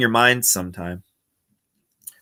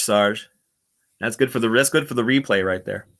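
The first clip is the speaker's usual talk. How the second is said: ordinary talk, nothing marked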